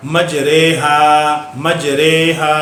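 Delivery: मजरेहा sung slowly with the ra bent toward re; imala pronunciation. Shown as a demonstration. Teacher reciting मजरेहा as it is read in Quran. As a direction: draw out मजरेहा, vowel between i and a. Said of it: "इन दोनों के बीच में ही पढ़ना है".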